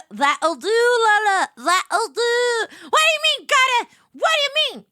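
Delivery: high, loud mock indignation; shrieking comic protest; mock outraged shout. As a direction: yell it in playful outrage.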